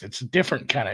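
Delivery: ordinary delivery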